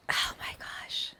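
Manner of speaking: slow breathless whisper; awed whisper. Real, not acted. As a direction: breathe it out in awe. Hushed wonder.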